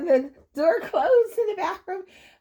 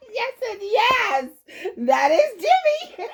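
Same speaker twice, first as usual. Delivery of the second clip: ordinary talk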